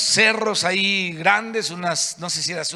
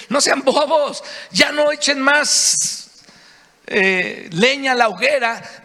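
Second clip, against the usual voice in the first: higher and louder, exasperated scolding; scolding rebuke; 7 semitones higher, 5 dB louder, slower than usual. Retold or imitated. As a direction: scold the people to stop. Moses scolding the people.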